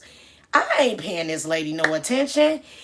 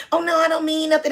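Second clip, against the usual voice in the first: put-on gushing drunk lady; 8 semitones higher, 3 dB louder, faster than usual. Imitating the lady again.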